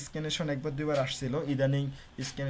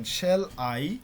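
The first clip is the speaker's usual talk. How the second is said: light "Shall", then a louder, drawn-out "I"; iambic stress demo; 4 dB louder than usual. Shown as a demonstration. Teacher shows students which syllable is stressed, iambic stress demo. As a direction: lean hard on "I" after a quick, easy "Shall". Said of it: "উচ্চারণটা খেয়াল করবেন".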